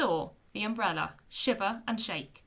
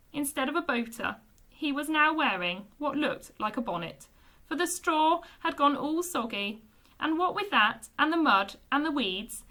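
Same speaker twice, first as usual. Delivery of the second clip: usual speaking voice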